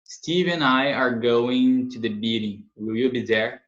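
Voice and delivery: slow, careful reading of the example; slow model sentence. Shown as a demonstration. Teacher reading the example slowly for the class. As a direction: read it slowly, word by word. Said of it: "slow model reading".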